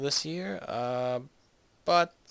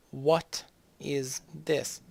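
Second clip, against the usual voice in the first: drawn-out question; 2 semitones higher, much slower than usual. Pretend. Slow repeat of question.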